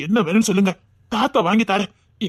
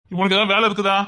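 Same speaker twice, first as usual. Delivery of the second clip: usual speaking voice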